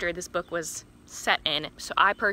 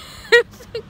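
loud laugh; 14 dB louder than usual. Real, not acted. Laughing at the joke name.

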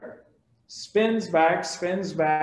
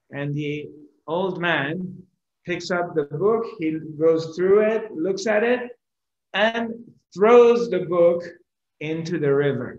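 usual speaking voice

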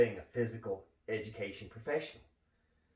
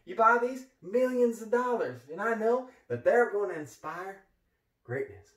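hyped up; 9 semitones higher, 6 dB louder than usual. Acted. Hyped about famous art.

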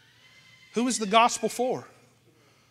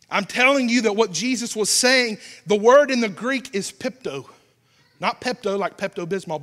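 normal talking voice

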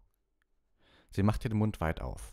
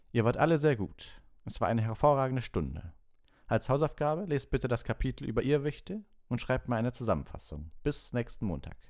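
higher, animated teacher voice; pleased teacher; 7 semitones higher than usual. Acted. Lupin dismisses the class with homework, pleased and animated. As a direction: praise the class, then set homework.

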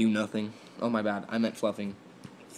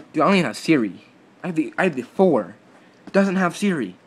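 loud, high outraged griping; indignant complaint; 6 semitones higher, 8 dB louder, faster than usual. Real, not acted. Teen complaining to viewers about his old phone, loud and outraged. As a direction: vent like it's deeply unfair.

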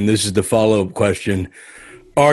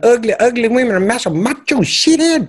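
a man lightly doing a woman's voice; 14 semitones higher, faster than usual. Acted. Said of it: "that's your woman voice?".

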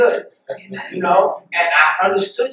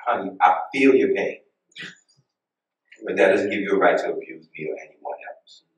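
much lower and softer, gently firm; calm; 12 semitones lower, 9 dB quieter than usual. Retold or imitated. Preacher calmly telling the cashier not to take it out on her, softly. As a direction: soothe her while setting a boundary. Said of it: "I said it so calm".

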